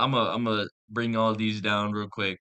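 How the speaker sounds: usual speaking voice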